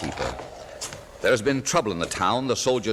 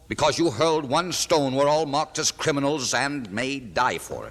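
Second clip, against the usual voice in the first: angry rebuke; 6 semitones higher than usual. Acted. Innkeeper berates rebellious nephew.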